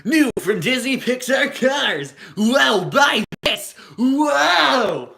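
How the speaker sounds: loud, high, excited ad shouting; Cars commercial announcer. Retold or imitated. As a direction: hype toy commercial voice. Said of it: "Cars commercial, but I can try".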